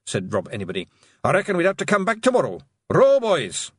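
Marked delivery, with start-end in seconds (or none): 1.2-3.7 fast, high, loud pixie shout; rally the rowers in a hurry; urgent order. Acted. Pixie chief to his crew, loud high shout.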